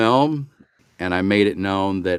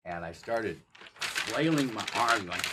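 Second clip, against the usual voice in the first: ordinary talk, nothing marked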